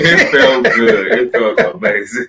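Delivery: loud, high and laughing; gleeful laughing. Real, not acted. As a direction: crow it through real laughter. Gleeful teasing over the bus joke.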